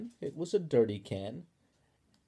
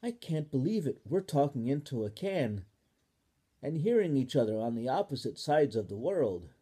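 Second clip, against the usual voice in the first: put-on louder, eager amazed child; amazed Ball; 4 semitones higher, 6 dB louder than usual. Ball marvels to Spoon, loud and amazed. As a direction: gush with wonder.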